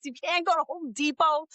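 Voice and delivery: ordinary delivery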